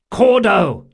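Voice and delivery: loud, high call across a distance; shout. A narrator's reading. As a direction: call out the name at full volume. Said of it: "he shouted".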